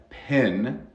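"pen" with a steady short e. Shown as a demonstration. Teacher contrasts pluma with sartén, clear short-e "pen". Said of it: "La diferencia entre pluma y sartén".

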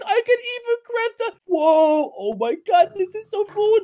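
usual speaking voice

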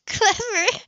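bright high laugh, then the word; a quick laugh before clever; amused laugh. Acted. She laughs at his pitch.

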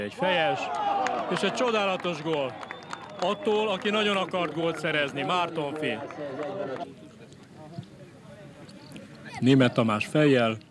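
high, loud goal call; excited hungarian sports commentary. Real, not acted. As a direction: shout the goal with delight.

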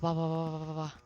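normal talking voice

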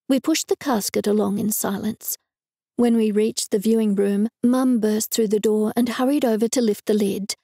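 usual speaking voice

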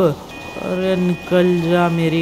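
slow, drawn-out begging whine; desperate pleading. Acted. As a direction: plead slowly, stretching the words out. Begs the stuck car to move.

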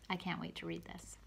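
usual speaking voice

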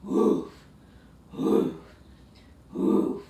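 breathy airy little woofs; puppy woof. Pretend. Teacher doing puppy pose, breathy woofs. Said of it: "puppy dog pose".

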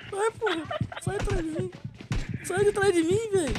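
high frantic shouting; panicking. Real, not acted. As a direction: yell at the chaser in panic. Zombie chasing him.

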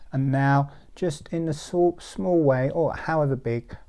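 usual speaking voice

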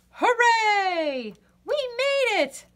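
very high, loud cheer. Acted. Birdie and friends safe at last, loud joyful cheer. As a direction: cheer like you just escaped. Escape celebration.

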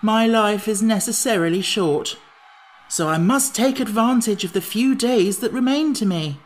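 high-pitched, emphatic gloom; gloomy. A narrator's reading. Pumpkinhead laments his few remaining days, gloomily. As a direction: lament your short life, voice lifted. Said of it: "said the Pumpkinhead gloomily".

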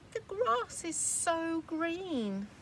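little goat sighing for grass; longing baby goat. Acted. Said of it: "the baby one, the little one, is going".

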